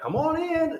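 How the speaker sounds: high-pitched beckoning call; playful imitated Noah beckoning; calling animals in.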